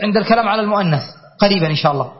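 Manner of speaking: ordinary talk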